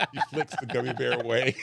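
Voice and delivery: normal talking voice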